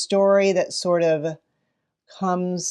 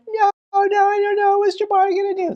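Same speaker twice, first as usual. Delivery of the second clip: put-on much higher excited kid voices; 4 dB louder, faster than usual. Kids answering teacher about the high dive, excited. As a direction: squeal like anxious children. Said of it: "now they're all excited".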